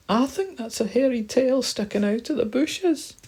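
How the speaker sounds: very high, alarmed little mouse voice; frightened mouse. Acted. Mouse seeing a hairy tail, squeaking with fear.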